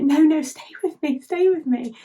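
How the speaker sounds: high, imploring acted voice; desperate plea. Asher begging the fading star, acted desperation.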